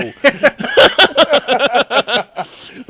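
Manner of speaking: big, high burst of laughter; loud laugh. Real, not acted. Host laughing at the guest story, big loud laugh. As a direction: crack up loudly.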